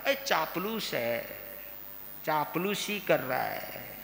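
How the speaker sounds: drawn-out imitation of people's jibe; mock accusation. People calling a sweet talker a flatterer, slow mock accusation. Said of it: "कई लोग बोल देते मीठे बोलने वाले को".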